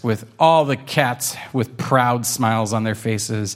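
plain reading voice

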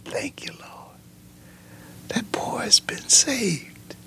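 whispered, awed thanksgiving; hushed retold prayer. Dad turned away thanking the Lord for his saved boy, retold whispered prayer. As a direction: whisper it like a father's private prayer.

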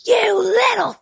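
very high, strained mock screech; enraged screaming mom. Friend's mom snapping at muddy kids from the porch, imitated enraged screech. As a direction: imitate a furious mother screeching, without real volume. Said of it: "was enraged. Like, she snapped".

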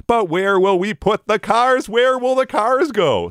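high, drawn-out mock worry; mock fretful locals. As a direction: imitate everybody fretting, slow and high-pitched. Mimics Minnesotans' universal parking question.

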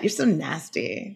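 slow, teasing scold; mock-scold him playfully. Real, not acted.

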